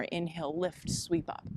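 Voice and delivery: soft, unhurried flow cues; yoga cueing. Acted. Teacher cueing her yoga class, soft and unhurried. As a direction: guide the class through each breath.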